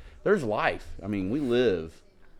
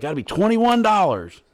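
high, louder bartender announcing the bill; put-on bartender voice, higher; 11 semitones higher, 6 dB louder than usual. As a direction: ring them up like a bartender. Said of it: "he's like".

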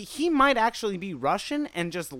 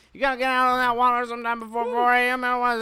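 put-on high, fast mumbled Bayou accent; Bayou talk; 5 semitones higher, faster than usual. Joining in on the fisherman imitation, rapid Bayou accent. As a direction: rattle it off like a garbled Bayou local. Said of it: "that's Bayou talk".

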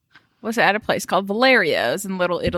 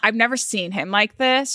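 ordinary talk, nothing marked

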